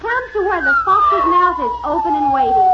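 rising, excited shout; dramatic shout. A narrator's reading. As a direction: build it up loud and high. Narrator's dramatic fall, shouting.

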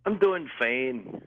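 put-on Scottish lilt, drawn out; Scottish accent. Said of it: "giving me a little Irish accent".

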